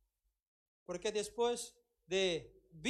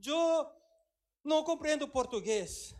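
congregant's excuse; 5 semitones higher, 3 dB louder than usual. Retold or imitated.

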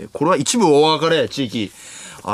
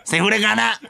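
put-on very high, crude child-star caricature; 芦田愛菜; 7 semitones higher than usual. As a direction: squeak it out as a fake little girl. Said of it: "芦田愛菜ちゃん、". (芦田愛菜のものまねのものまね、甲高く)

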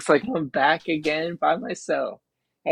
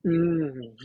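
usual speaking voice

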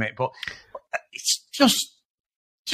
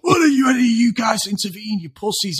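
high, hammed-up mock shout; terrible acting; 8 semitones higher than usual. Actor challenges onlookers in a burger joint, hammed-up shouting. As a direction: imitate a bad actor challenging a crowd. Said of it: "Billy's acting's terrible".

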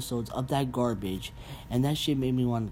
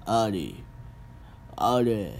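mock tone-deaf delivery; slower than usual. Shown as a demonstration. Vlogger mocking Korean drama dialogue, mild imitation. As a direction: lightly mimic the drama actors' flat "Ari". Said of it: "they talk like they're so tone deaf".

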